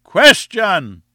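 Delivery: loud, high crowd heckle; yell. A narrator's reading. Students gleefully joining the professor's interruption, yelling. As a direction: yell it out across the hall. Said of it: "there was a yell of".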